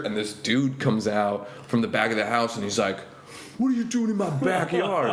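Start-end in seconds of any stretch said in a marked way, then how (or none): 3.6-5.1 high, hammed-up indignant demand; mock the guy's outrage; mock angry homeowner. Comedian imitates a man catching him in the backyard, high mock anger.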